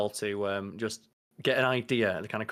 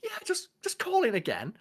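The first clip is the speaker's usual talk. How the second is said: very high, tentative polite caller; mock-meek telephone voice; put-on phone voice. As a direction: pitch way up as an apologetic caller. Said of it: "you've gotta do that thing where it's like".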